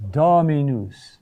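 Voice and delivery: Dominus, slowly, with a long stressed DO; stress on third-to-last syllable. Shown as a demonstration. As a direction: hold the DO of Dominus, let the rest fall. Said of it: "The third to last syllable".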